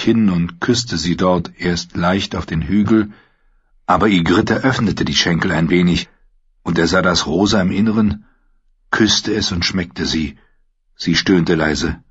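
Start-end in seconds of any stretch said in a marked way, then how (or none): none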